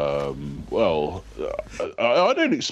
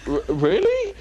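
shocked squeak, much higher; mock shock; slower than usual. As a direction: gasp out a wounded really. Host feigns shock at insult.

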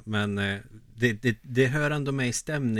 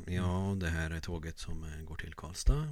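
low, soft train-host announcement; 4 semitones lower, 5 dB quieter than usual. Acted. Host imitates train staff to passengers, low announcement voice. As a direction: drop low and quiet, hesitating over the intercom. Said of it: "Tågvärdar är lite".

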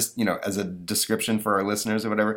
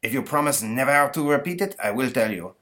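high, secretive Frenchman; confiding French accent; 7 semitones higher than usual. Acted.

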